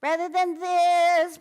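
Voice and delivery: high, loud, slow, strangled squeeze. Shown as a demonstration. Voice teacher demonstrates a strangled tone to avoid. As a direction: squeeze the throat tight on purpose. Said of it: "which sounds a little strangled".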